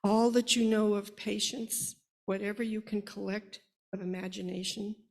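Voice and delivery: measured, heartfelt poem reading; reciting a poem to an audience about firstborn children; poem recitation. Acted. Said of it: "a simple poem called Firstborn".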